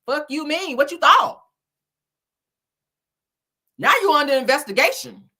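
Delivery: ordinary talk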